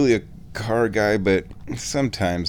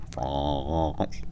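low, drawn-out French "France"; hammed-up French accent, pitched low; 8 semitones lower, 6 dB quieter than usual. Vlogger playing with the country's name, hammed-up French accent. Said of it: "That's a pretty good French accent".

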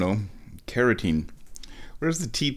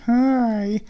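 high cutesy greeting to the tea leaves; squeaky hi; 13 semitones higher than usual. Acted.

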